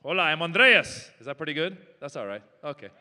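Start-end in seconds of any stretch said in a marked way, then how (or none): 0.0-1.1 louder mock Spanish greeting as Andreas; hammed-up host saying hello; Andreas impression. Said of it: "That's my Andreas impression".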